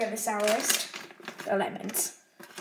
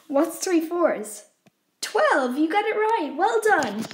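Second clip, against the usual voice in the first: playful quizzing teacher; 5 semitones higher, 6 dB louder, slower than usual. Pretend. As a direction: praise the viewer like a teacher. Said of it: "mock quiz for viewers".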